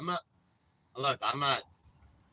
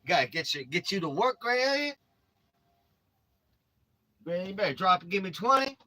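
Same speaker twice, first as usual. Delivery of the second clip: loud, higher drill sergeant bark; barking gym coach; 5 semitones higher, 4 dB louder than usual. Acted. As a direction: bark orders like a boot camp coach. Yelling at Granny to exercise.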